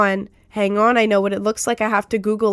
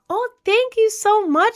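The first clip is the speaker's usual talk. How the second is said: excited; 11 semitones higher, 6 dB louder than usual. Real, not acted. Thanking for a bit donation.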